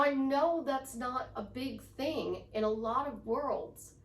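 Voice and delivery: ordinary talk, nothing marked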